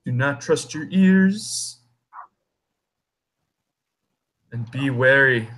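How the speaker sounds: a hint of an ominous old tree; talking tree. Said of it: "the tree says".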